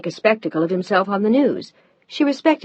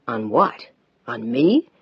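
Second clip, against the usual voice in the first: shocked; 7 semitones lower, slower than usual. A narrator's reading. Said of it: "He sounded shocked".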